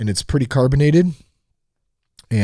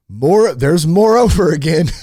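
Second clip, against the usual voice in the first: mock outrage; 6 semitones higher, 6 dB louder than usual. Real, not acted. Mocking repeated word.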